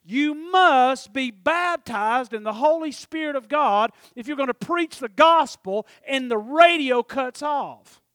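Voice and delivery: loud, slow, high radio preacher bellow; hammed-up old-time radio preaching. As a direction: thunder it like a radio evangelist. Radio preacher quoted mid-drive.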